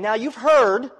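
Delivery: a lifted apostle's voice quoting the letter; quoting Paul. Retold or imitated. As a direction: read Paul's words as a pleading apostle.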